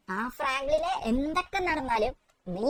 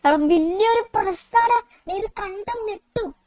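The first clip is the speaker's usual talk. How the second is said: Shinchan voice; 5 semitones higher, slower than usual. Acted.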